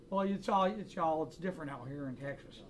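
put-on Western-movie Texas voice; Texan accent. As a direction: imitate a buddy turned Texan. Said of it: "talks like a Texan".

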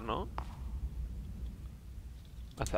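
ordinary talk, nothing marked

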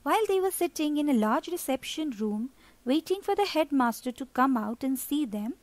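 ordinary delivery

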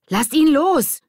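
high, forceful yell; alarmed shout. A narrator's reading. Lilly leaping to Erik's rescue, shouting. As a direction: yell it as an order. Lilly rushes to help Erik.

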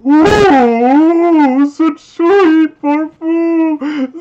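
high, slow swooning voice; creepy drawn-out moan. Acted. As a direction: swoon over the scent, slowly. Creepy sniffing of Samus.

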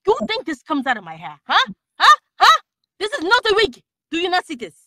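put-on indignant, high and loud in accent; Storm. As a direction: challenge them, incredulous and offended. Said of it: "she's just like".

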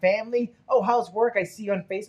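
chatty dentist. Retold or imitated. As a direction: rattle off questions like a chatty dentist. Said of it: "But she went".

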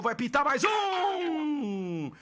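slow build into high goal shout; explosive goal commentary. Real, not acted. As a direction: stretch the build, then explode.

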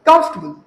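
कंफर्टेबल as "KUMF-tuh-bul"; reduced comfortable pronunciation. Shown as a demonstration. Teacher modelling for students, कंफर्टेबल as "KUMF-tuh-bul". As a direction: squeeze कंफर्टेबल into three beats. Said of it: "हुण कंफर्टेबल word लगदा ना".

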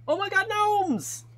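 high, slow, squealing delight; excited squeal. Pretend. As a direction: squeal it like a thrilled fan. Reading excited chat message.

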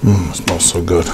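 soft low hum of delight; savoring a smell. Real, not acted. Smelling the cookie dough.

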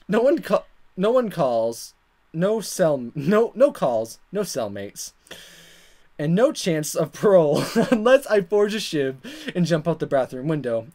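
ordinary delivery

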